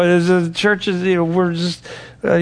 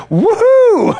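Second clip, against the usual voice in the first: high whooping crowd cheer, much louder; excited cheer; 6 dB louder than usual. Retold or imitated. The soldiers cheering for their new king, excited whoop. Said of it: "but they're all excited".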